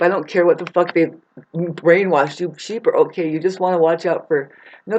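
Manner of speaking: usual speaking voice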